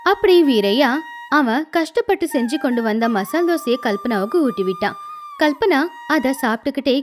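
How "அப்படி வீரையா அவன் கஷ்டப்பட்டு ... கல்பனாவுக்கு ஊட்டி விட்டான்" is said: ordinary delivery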